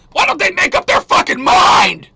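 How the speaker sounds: high, loud exasperated yell; furious shout. Real, not acted. Host ranting at Iran's mixed signals, furious shout. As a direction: blow up at the contradictory news. Ranting about Iran flip-flopping.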